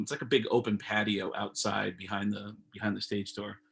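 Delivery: ordinary talk, nothing marked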